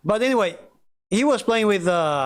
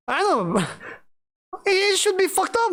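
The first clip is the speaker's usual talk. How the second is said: laughing; 11 semitones higher than usual. Real, not acted.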